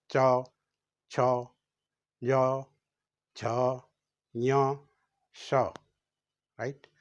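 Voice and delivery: च, छ, ञ, श said with the tongue on the palate; slow palatal letter drill; palatal letters demo. Shown as a demonstration. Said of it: "इसको कहेंगे तालव्य।".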